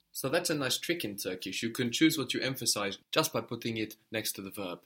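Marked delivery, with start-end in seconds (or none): none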